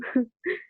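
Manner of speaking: brief amused chuckle at the easy odds; a light, confident laugh; short chuckle. Acted. Captain on air, amused by the tiny target, short chuckle. Officer chuckles at troops closing in.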